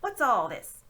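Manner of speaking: loud official demand; stern constable. Acted. Policeman questions Uncle Andrew, stern and loud. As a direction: bark it at him.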